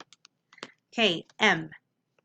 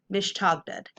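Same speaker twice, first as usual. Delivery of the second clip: mish, tog, dud run together; blended whole word. Shown as a demonstration. Teacher reading the whole word to students, blended. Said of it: "Whole word".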